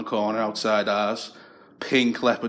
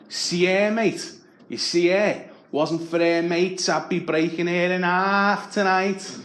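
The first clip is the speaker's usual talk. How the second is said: nightclub lad; 6 semitones higher, slower than usual. Retold or imitated.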